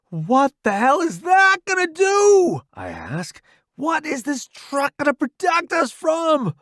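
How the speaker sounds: high frantic yell; shouting, scared stiff; panicked. Acted. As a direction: scream the question in terror. Panicked recruit under bombing.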